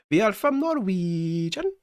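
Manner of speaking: high, slow, drawn-out fake Norwegian; mock Norwegian accent. Streamer clowning for viewers, exaggerated mock Norwegian accent. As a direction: stretch it out in a hammed-up Norwegian accent. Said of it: "like, a Norwegian accent".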